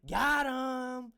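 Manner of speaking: high, loud victory yell; triumphant shout. Retold or imitated. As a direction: shout it like you just won. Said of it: "and I said".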